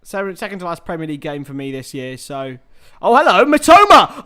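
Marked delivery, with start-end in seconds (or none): 3.0-4.2 loud, high football shout; excited match reaction. Real, not acted. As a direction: burst out as the chance opens up. Live reaction to a chance.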